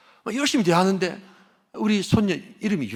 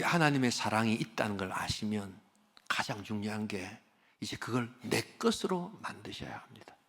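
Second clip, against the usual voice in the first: softer, hushed whisper; 7 semitones lower, 8 dB quieter than usual. Real, not acted. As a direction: whisper the appeal close. Preacher whispers the appeal. (조용히 속삭이며 권하듯)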